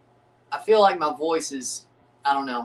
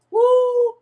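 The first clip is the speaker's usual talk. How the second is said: high, loud whoop of joy; cheering whoop; 8 dB louder than usual. Real, not acted. Fan cheering a Blue Jays homer, high loud whoop. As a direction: let out a celebratory woo. Celebrating Jays home run.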